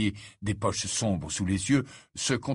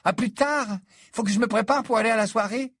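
put-on girl voice; 12 semitones higher, 4 dB louder than usual. A narrator's reading. Hermione voiced, high.

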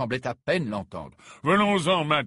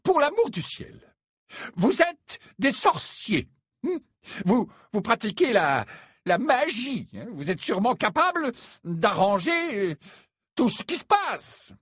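much higher, louder, exasperated; bursting out; 12 semitones higher, 3 dB louder than usual. Acted. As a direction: let it all burst out. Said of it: "les mots ... jaillirent enfin". (avec exaspération, d'une voix aiguë)

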